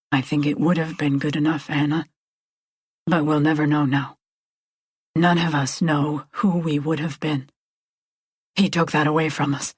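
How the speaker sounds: low, resigned reply; sombre man. A narrator's reading. Man answers sombrely after long silence.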